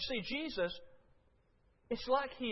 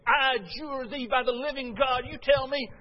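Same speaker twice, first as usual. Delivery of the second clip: loud, higher, furious order; enraged demand; 5 semitones higher, 6 dB louder than usual. Retold or imitated. High priest Caiaphas furiously demanding Jesus answer, loud angry voice. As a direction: spit out the command in rage. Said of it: "He was so angry".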